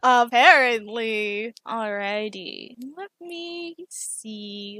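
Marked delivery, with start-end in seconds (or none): 3.0-4.8 mulling it over. Real, not acted. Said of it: "GM muses over the choice".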